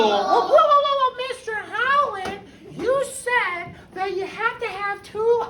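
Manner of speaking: high, hammed-up voice of a protesting student; mock student objection. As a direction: squeal like a kid catching the teacher out. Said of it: "I'm just saying".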